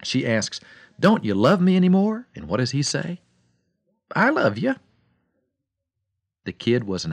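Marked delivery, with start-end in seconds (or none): 1.0-2.3 high, loud pleading question; confronting wife. Retold or imitated. Wife confronts her absent husband, high and pleading. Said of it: "She finally confronts him". 4.1-4.8 offhand reply. Retold or imitated. Husband's reply to wife.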